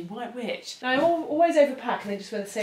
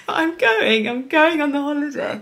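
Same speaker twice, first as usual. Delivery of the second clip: laughing and crying at once, higher and louder; tearful joy breaking through; real overwhelmed crying with happiness; happy tears; 6 semitones higher, 10 dB louder than usual. Real, not acted. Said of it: "I don't know why I'm crying".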